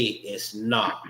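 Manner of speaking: ordinary talk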